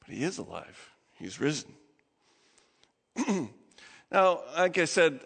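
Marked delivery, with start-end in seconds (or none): 0.1-1.7 much softer and lower, reverent; preacher's soft, awed declaration; hushed proclamation. Real, not acted. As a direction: drop to a near hush for the good news. Climax of resurrection sermon.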